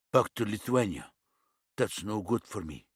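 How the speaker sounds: put-on light East European accent, blunt demand; a hint of an East European tough. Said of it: "His accent was some sort of East European".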